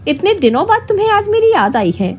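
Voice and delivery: a touch of peeved reproach; mildly annoyed. A narrator's reading. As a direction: show a little hurt anger. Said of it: "थोड़ा गुस्सा दिखाते हुए अंजलि बोली".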